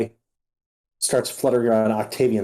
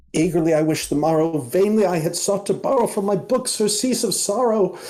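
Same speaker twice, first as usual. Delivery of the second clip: high recited verse; 7 semitones higher than usual. Acted. Butterfly reciting poem.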